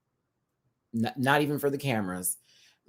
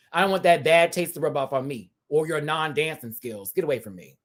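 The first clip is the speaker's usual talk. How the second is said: ordinary talk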